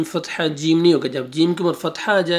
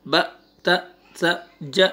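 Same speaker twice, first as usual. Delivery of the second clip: آ، با، تا، سا، جا، حا cut short with a jolt on each; jerky reading; 3 semitones higher, faster than usual. Shown as a demonstration. Teacher shows students what to avoid, jerky short آ، با، تا، سا، جا، حا. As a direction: jerk out each syllable of آ، با، تا، سا، جا، حا. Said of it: "جھٹکے کے ساتھ پڑھنا ہے".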